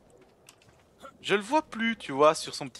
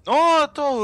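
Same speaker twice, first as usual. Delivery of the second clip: panicked; 12 semitones higher, 8 dB louder, slower than usual. Real, not acted. Panic in game.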